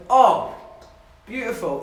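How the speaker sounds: loud, high "Oh" sinking into "beautiful"; delighted. Real, not acted. Streamer tells chat about the gnocchi, loud and delighted. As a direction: savour it like the dish just arrived.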